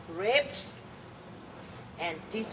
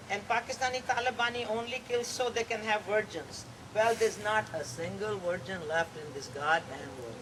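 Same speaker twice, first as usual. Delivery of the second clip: normal talking voice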